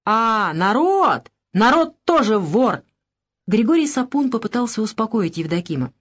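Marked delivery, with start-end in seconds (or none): 0.1-2.8 slow, drawn-out furious outburst; enraged rasp. A narrator's reading. Евдоким turns on the whole crowd, hoarse with rage. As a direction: drag the words out in trembling rage. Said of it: "прохрипел".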